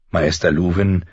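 plain reading voice

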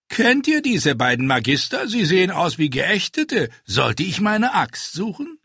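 a hint of a cocky little man showing off; cheeky dwarf. A narrator's reading. Dwarf jokes to the riders and Illyrio, lightly cocky. Tyrion voiced in dialogue.

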